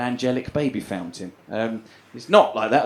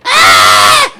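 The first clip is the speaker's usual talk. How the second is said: sudden very loud scream; labour scream; 6 semitones lower, 15 dB louder than usual. Retold or imitated. Girlfriend starting labour at home, sudden loud scream. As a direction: scream out in imitated labour pain.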